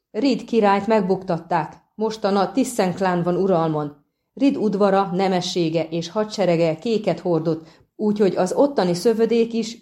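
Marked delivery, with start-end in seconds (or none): none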